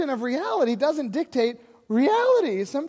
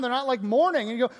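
ordinary talk

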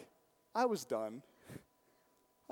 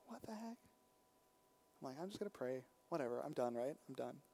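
muttered giving up; 5 semitones lower, 9 dB quieter than usual. Retold or imitated.

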